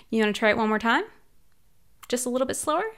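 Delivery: normal talking voice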